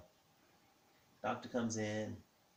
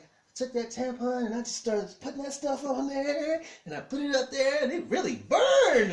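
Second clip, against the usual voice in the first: high, loud wail building to a shout; distressed wife; 14 semitones higher, 11 dB louder than usual. Retold or imitated. Wife telling husband how the medicine burned, distressed. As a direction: imitate a wife's mounting shrill outrage. Wife recounts burning drops.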